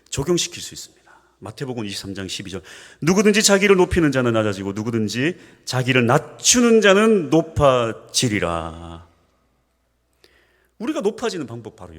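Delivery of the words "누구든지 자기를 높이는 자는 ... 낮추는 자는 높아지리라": loud, slow solemn recitation; scripture reading. Acted. Pastor reading Matthew to the church, loud and slow. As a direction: proclaim the verse deliberately.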